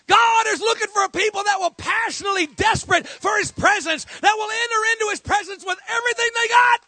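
fiery shouted sermon; full-force preaching. Acted. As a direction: preach it hard to the church.

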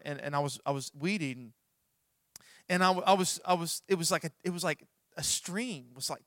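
usual speaking voice

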